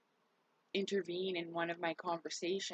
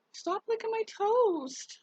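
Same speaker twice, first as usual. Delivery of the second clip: high, loud, slow, whiny yell; scolding yell; 6 dB louder, slower than usual. Real, not acted. Yelling at the pet to stop licking her toast, high and whiny.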